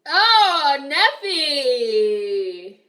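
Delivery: loud, high call with a laugh in it. Real, not acted. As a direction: shout the name across the room. Calling out a chat member.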